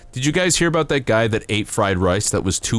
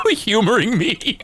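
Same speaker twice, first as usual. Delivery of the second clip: very high squeaky laughing around the words; giggling. Real, not acted. Streamer laughing at being humored, squeaky giggles.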